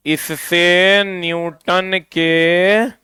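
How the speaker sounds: इससे न्यूटन के drawn out very slowly, word by word; slow dictation. Shown as a demonstration. Teacher dictating slowly to the class. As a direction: stretch each word so they can write it down.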